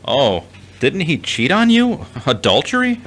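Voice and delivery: shocked. Acted. Brother shocked at sister's news.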